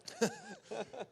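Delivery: bursting, high-pitched laugh. Real, not acted. Laughing after the cheer.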